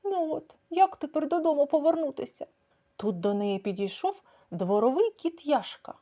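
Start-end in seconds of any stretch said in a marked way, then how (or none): 0.0-2.5 high worried cat voice; lost cat. A narrator's reading. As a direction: fret like a lost little cat. Загублена кішка бідкається. (високий стурбований голос кішки)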